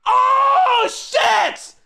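very high, loud cry of shock; pained yell. Real, not acted. Man getting his armpit waxed, pained yell. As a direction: scream out as the strip rips. Painful shock during waxing.

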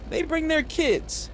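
ordinary delivery

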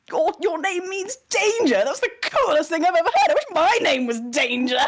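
high, fast, gushing delight; excited party member. Retold or imitated. Toby's character gushing over the name Danger, high and fast. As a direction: gush over how cool the name is. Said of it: "Toby's character was just like".

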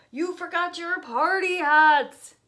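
loud, slow call after them; calling bear. Acted. Bear calling to departing guests, loud and slow. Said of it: "said Bear".

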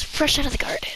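high breathy whisper. Pretend. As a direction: whisper it like a secret. Whispered aside about beans.

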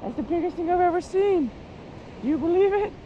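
high, excited voice for a dog; dog-talk voice. Real, not acted. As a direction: gush to the dog over a stick. Talking to the dog about stick.

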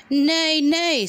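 high whinnying neigh; horse neigh. Acted. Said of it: "said the horse".

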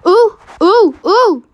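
high, loud hoots; excited oohs. Real, not acted. Player reacts to the game, hooting excitedly. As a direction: hoot each one up high.

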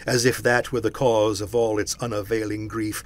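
ordinary delivery

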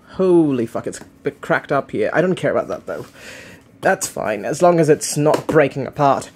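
ordinary talk, nothing marked

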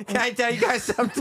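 lightly voiced little kid through laughter; mimicking a child. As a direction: imitate a small boy's high question while laughing.

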